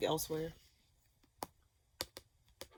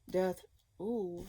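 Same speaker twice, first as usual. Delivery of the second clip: usual speaking voice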